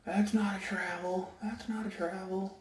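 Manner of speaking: high mock whine of protest; complaining kid. Player protesting a travel call, high mock whine. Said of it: "starts complaining".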